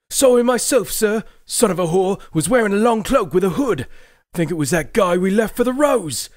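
high, loud voice of a nervous northern soldier; rough soldier Dirk. Acted. Dirk reports to his commander Morgrig, high anxious soldier voice. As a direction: answer your commander, anxious and eager.

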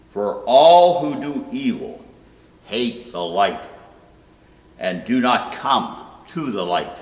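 usual speaking voice

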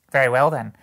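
brazen woman crying out, high; loud cry. A narrator's reading. Woman settles the matter with her companions, loud cry. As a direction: burst out loudly to settle it. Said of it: "cried the woman".

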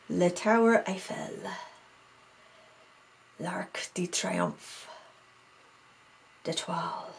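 slow, soft attempt at French pronunciation; terrible French accent. Shown as a demonstration. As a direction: read it out quietly in a bad French accent. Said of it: "Terrible French accent".

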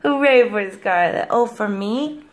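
usual speaking voice

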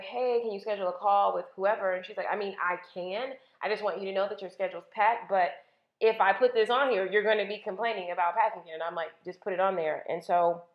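usual speaking voice

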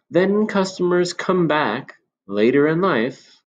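put-on a knowing old shopkeeper confiding; old man. Old man voiced in story.

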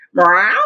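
loud mock meow like a cat. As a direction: meow like a cat, full volume. Cat sound in story.

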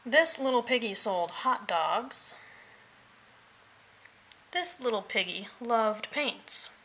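usual speaking voice

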